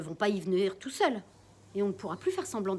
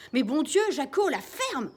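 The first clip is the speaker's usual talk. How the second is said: loud, exasperated yell; angry shout; 6 semitones higher, 8 dB louder than usual. Acted. Father yelling at the squawking parrot to shut up. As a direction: bark at the bird to shut up.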